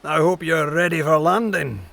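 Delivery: low, projected character voice; carrying captain's voice. A narrator's reading. Captain calling to Lady Williams across the ship, voice carrying. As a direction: call easily across a deck. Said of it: "a voice traveling easily across the ship".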